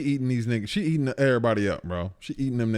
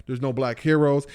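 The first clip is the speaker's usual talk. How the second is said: ordinary talk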